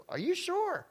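high, disbelieving question; incredulous. Real, not acted. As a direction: check if they've lost their minds. Disbelief at the people's choice.

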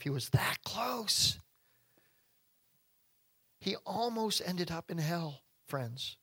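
hushed, slow whisper. Real, not acted. Preacher whispering how close the jailer came. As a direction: lean in and whisper it. Preacher stresses near miss.

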